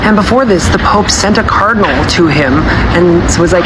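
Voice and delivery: ordinary delivery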